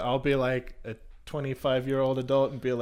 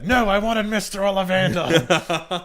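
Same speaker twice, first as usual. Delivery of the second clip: higher, louder, slow whine; mock kid complaint; 8 semitones higher, 9 dB louder, slower than usual. Pretend kid demanding Mister Ollivander, loud slow whine. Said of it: "the lady comes out".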